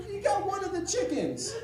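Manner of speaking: very high mock-crying wail; ugly crying. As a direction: imitate a wife wailing the bad news. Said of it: "she, she ugly cries".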